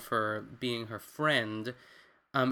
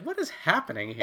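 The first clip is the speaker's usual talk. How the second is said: baffled; 8 semitones higher than usual. Retold or imitated. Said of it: "I was like".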